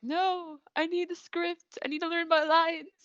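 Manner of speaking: high-pitched imitation of desperate pleading; mock pleading. Imitating Toru chasing Kyo for the script, pleading.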